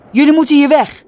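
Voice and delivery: loud, high urgent call. A narrator's reading. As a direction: call out a warning to everyone. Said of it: "roept Van der Hoeven".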